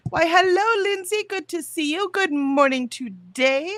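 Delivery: high, loud delighted hello; excited greeting. Real, not acted. Streamer greeting Lindsay arriving in chat, high and loud with delight.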